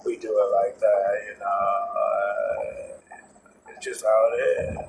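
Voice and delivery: lazy dragged-out celebrity talk; mock trendy drawl. Mocking how cool people talk now, slow dragged-out drawl. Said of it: "I'm glad my wife doesn't talk like that".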